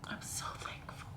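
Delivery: breathless tearful whisper. Real, not acted. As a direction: barely voice the words. Whisper to lost baby.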